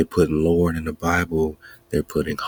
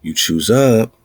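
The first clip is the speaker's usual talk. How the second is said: louder, driving the phrase home; emphatic call; 4 semitones higher, 6 dB louder, slower than usual. Real, not acted. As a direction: hammer it home to the crowd. Emphatic preaching point.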